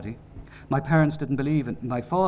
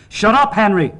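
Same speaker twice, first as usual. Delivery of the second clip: high, full-volume bellowing; enraged father screaming for silence; furious roar; 9 semitones higher, 13 dB louder than usual. A narrator's reading. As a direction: roar it right into the face. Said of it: "He stopped shouting at me".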